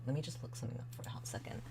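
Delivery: quick unvoiced whisper aside. Whispers while looking something up.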